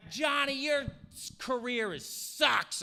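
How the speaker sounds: mock a whiny wife sneering at her husband; mocking Amber. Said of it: "She's like".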